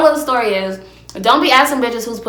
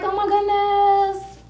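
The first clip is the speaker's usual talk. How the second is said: high, slow sing-song moan; hammed-up pleasure voice, much higher; mock moaning; 6 semitones higher, slower than usual. Shown as a demonstration. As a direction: coo it high and drawn out. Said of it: "you let out, like, a little moan".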